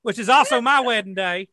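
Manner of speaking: put-on high-pitched Southern Godfather accent. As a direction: keep the thick Southern accent. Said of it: "real thick, uh, Southern accent".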